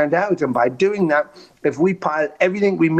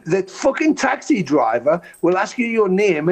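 ordinary talk, nothing marked

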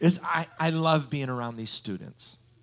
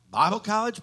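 "Bible college" pitched high and rising in disbelief; surprised; 11 semitones higher, 7 dB louder than usual. Retold or imitated. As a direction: ask "Bible college" as a shocked question. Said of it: "He said".

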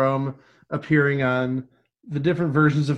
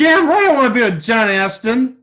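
loud, higher, fired-up insistence; raised voice, all in; emphatic agreement; 6 semitones higher, 7 dB louder than usual. Real, not acted. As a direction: insist with comic fervor. Mock-insisting on a game show wish.

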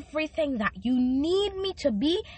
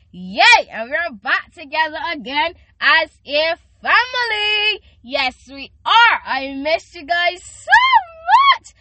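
loud, high cheering; excited; 7 semitones higher, 10 dB louder than usual. Real, not acted. Teen host greeting her listeners back, loud and excited. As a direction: celebrate being back.

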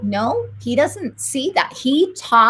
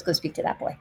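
softer, breathy whisper; hushed voice of God; whispered command; 6 semitones lower, 10 dB quieter, faster than usual. Retold or imitated. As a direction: whisper it like a divine nudge.

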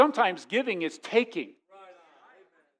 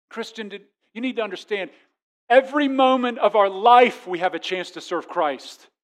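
ordinary talk, nothing marked

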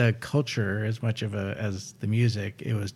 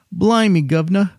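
high, loud put-on Cockney exclamation; mock Cockney; 10 semitones higher, 8 dB louder than usual. Host mocks Mary Poppins Cockney for British listeners. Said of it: "that's actually what they say in Mary Poppins".

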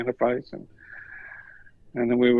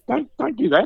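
high, loud acted alarm; imitated panicky objection; alarmed protest; 13 semitones higher, 6 dB louder than usual. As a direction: blurt a raised plea to stop. Police retold stopping a pin prick.

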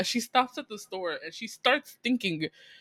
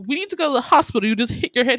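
higher, louder and fast, pushing him to go; urgent insistence; 4 semitones higher, 8 dB louder, much faster than usual. Retold or imitated. Coworker urging him to the hospital, fast and urgent. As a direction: rattle it off like an alarmed coworker.

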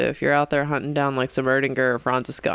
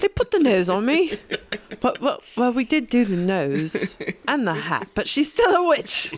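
high, hammed-up comic peasant voices; protest, then accuse in a silly high voice; comedy sketch peasant; mock witch-trial villagers; 6 semitones higher than usual. Acting out a comedy witch-trial scene.

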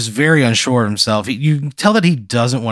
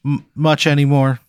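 nervous TV pitchman; 6 semitones higher than usual. Acted. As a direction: play an awkward local TV salesman. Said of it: "He goes".

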